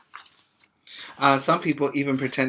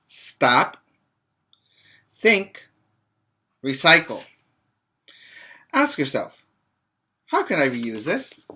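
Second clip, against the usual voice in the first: put-on reading to children. Teacher reading a picture book to her pre-K class, lively read-aloud.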